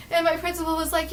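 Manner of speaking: ordinary delivery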